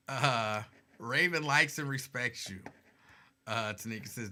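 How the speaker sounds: usual speaking voice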